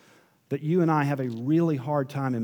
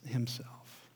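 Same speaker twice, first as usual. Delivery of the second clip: whispered; 2 semitones lower, 11 dB quieter than usual. Real, not acted. End of phrase, whispered.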